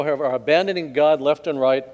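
usual speaking voice

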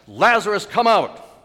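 loud, high-pitched call of command; ringing pulpit command; commanding shout. Acted. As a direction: cry it out to raise the dead. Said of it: "hear the command of Christ".

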